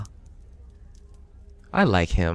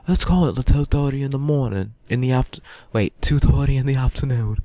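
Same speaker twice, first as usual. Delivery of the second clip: higher, cheery Cockney morning announcer; Cockney accent; 4 semitones higher than usual. Acted. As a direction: chirp the school news in a Cockney accent. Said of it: "she had the most Cockney accent in my head".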